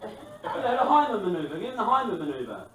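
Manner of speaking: insisting. Retold or imitated. Insisting loudly in retold scene.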